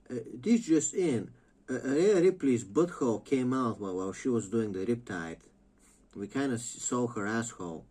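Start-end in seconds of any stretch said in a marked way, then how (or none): 0.1-5.4 hammed-up anchor delivering gossip; mock news read. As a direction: announce it like a newsreader.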